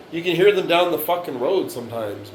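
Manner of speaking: usual speaking voice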